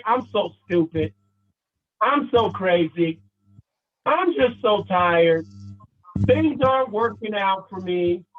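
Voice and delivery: low, slow, defeated-sounding example; mock dejected self-talk. Shown as a demonstration. Coach voicing never-again list examples, mock dejected. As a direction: drag out each put-down, pitched low.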